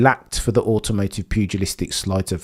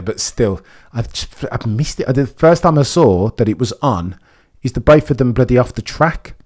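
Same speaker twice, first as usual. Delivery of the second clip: normal talking voice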